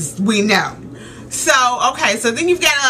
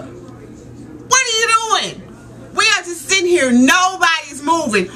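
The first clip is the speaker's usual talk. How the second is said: higher-pitched, fed-up protest; exasperated scolding; 6 semitones higher than usual. Retold or imitated. Daughter to her dad stuck in traffic, exasperated scolding. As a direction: scold the driver in disbelief.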